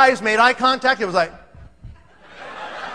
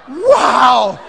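mock astonished wow; stunned reaction; 4 dB louder than usual. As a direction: draw out a loud, stunned wow.

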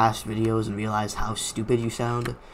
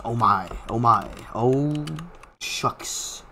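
usual speaking voice

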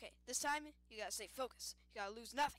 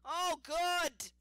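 excited yelp; 6 semitones higher, 11 dB louder than usual. Real, not acted. Sudden relief in game.

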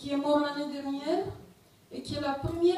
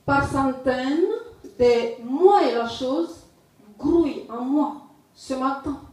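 deliberate recital in short, separated phrases; poetry reading; 5 dB louder than usual. Acted. Poet reading a poem on exile to listeners, poetry reading. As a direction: declaim the poem, pausing after each phrase. Reads own poem aloud.

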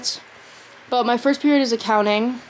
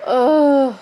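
long, higher groan of dread; exasperated groan; 4 semitones higher, 5 dB louder than usual. Real, not acted. Freaking out about school, exasperated groan. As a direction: groan out loud in frustration.

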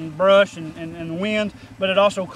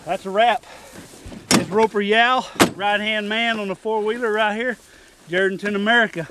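announcer; 4 semitones higher, 4 dB louder than usual. Acted. Show sign-off to camera.

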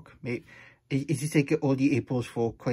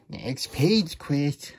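ordinary delivery

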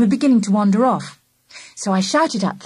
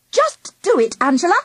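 higher, bossy command; bossy order; 9 semitones higher than usual. A narrator's reading. Charlie orders Angela to go, in a bossy voice. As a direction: boss her like a stern librarian. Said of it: "I ordered in a bossy voice".

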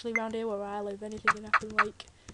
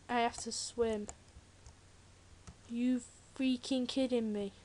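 ordinary talk

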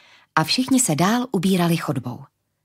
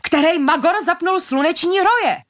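angry yell; 9 semitones higher than usual. A narrator's reading. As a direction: shout the question at everyone. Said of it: "hulákal Moretti".

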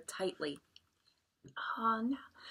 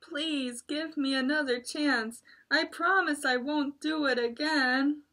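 begging; 6 semitones higher than usual. Acted. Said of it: "Tiger begged on his knees".

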